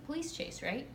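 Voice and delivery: ordinary delivery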